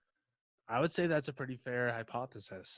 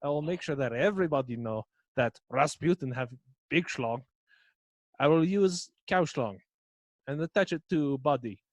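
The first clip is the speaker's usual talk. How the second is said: loud mock Russian boast; 6 dB louder than usual. Rasputin boasts to followers.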